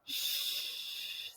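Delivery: soft, long voiceless "shh"; quiet sustained "shh"; isolated sound. Shown as a demonstration. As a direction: hold out the "shh" hiss. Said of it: "I hear shh".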